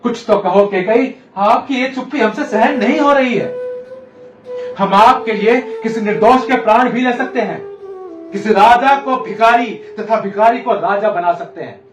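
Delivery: loud, high, impassioned vow; desperate pleading. Acted. King offering anything to his silent queen, desperate pleading. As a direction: plead loudly, swelling into grand promises.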